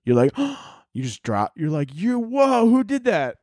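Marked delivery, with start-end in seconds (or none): 1.9-3.4 shocked. Acted. Acted shock over dead rats.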